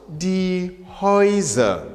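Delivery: slow, drawn out die Häuser; slow drill. Shown as a demonstration. Teacher drills plural slowly. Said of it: "we pronounce the word".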